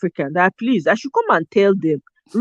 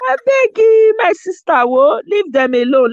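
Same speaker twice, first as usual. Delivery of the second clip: pleading; 10 semitones higher, 5 dB louder than usual. Acted. Pleading to a woman in a video.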